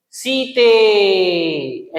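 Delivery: very slow, stretched call of Sita's name; drawn-out call. Shown as a demonstration. Teacher demos Ram calling Sita, stretched long. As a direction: hold the vowel long, calling through the forest. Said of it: "ऐसे करके देखो लंबा हो रहा है".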